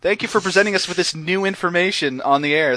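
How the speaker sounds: high, gushing imitation of polite interest, easing down; mock so-nice enthusiasm. Host imitating co-hosts politely humoring him, mock-nice. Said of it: "they're being so nice".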